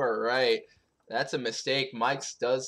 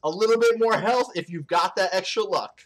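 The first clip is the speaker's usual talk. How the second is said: mock ad read; 7 semitones higher, 4 dB louder than usual. Continues the mock banana ad.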